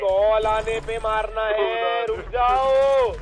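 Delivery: very slow, every word stretched out; drawn-out call. Real, not acted. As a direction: call out the order in long, held syllables. Calling out to the players to wait. (खींच-खींच कर पुकारते हुए)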